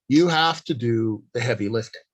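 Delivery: usual speaking voice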